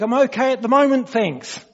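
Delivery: high, quick call back from afar; shouted reply. Acted. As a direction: call it back while falling past. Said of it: "the reply comes back".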